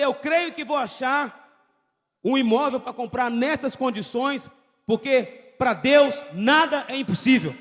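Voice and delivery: slow, loud declaration of faith; proclaimed loudly. Retold or imitated. Preacher retelling his own ad, declaring it loud and slow. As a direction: proclaim it slowly with full conviction. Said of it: "Eu falei".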